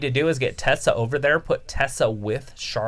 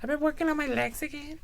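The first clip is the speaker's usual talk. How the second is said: man doing a woman's voice. Pretend. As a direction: do the girl voice on request. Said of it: "my girl voice?".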